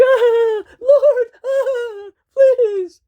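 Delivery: very high, loud wailing plea; terrified wail. Acted. Wife crying to the Lord, very high wail. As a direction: shriek a prayer.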